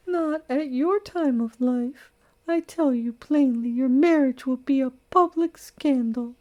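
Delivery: high, prim matron voice, reproachful; a scandalised elderly friend lecturing her; disapproving old lady. Acted. Old lady scolds the bride.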